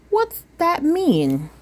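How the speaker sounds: high, slow innocent question; puzzled child. Acted. Sophia asking mother, high and slowly puzzled. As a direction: ask it slowly like a confused little girl. Sophia asks about the word.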